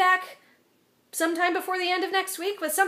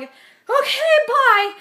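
panicked yelp; 8 semitones higher, 9 dB louder, much slower than usual. Retold or imitated. Panicked exit from the meeting.

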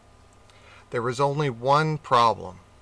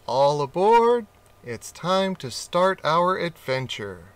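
louder, higher all aboard; excited call; 4 semitones higher, 3 dB louder than usual. Acted. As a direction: call your friend into the boat. Rat calling out to cat.

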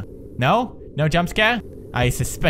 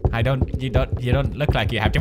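normal talking voice

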